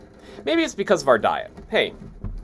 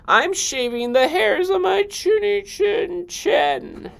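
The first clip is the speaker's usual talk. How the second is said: very high, slow pig voice; squeaky pig voice; slower than usual. Acted. Second pig refusing the wolf from inside. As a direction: squeal it like a fussy little pig. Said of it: "He yelled back".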